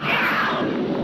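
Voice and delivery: loud breathless mock big-cat cry; mountain lion yowl. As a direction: imitate a cheap mountain lion effect. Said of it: "it sounds like a mountain lion".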